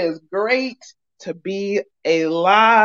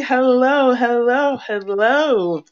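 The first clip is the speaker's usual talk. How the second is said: drawn-out greeting; 6 semitones higher, much slower than usual. Real, not acted. Stretched show greeting.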